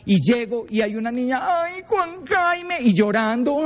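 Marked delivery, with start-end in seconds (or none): 1.4-2.8 put-on high, slow whiny girlish plea; imitating a scared girl calling for help. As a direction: stretch it out like a frightened teenage girl. Frightened girl calls the priest.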